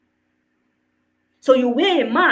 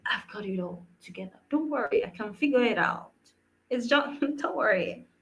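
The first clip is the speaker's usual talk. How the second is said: soft, dismissive reassurance with a small chuckle; hushed and low at first, brushing it off; acted composure; 3 semitones lower, 8 dB quieter than usual. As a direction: quietly wave away any concern, pretending to cope. Voicing the mask brushing off concern.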